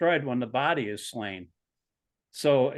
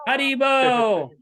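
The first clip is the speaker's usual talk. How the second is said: imitating a child; 11 semitones higher, 8 dB louder than usual. Mimicking the child's greeting.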